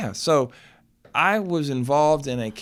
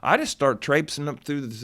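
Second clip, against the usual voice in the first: ordinary delivery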